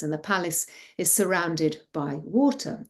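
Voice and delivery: ordinary delivery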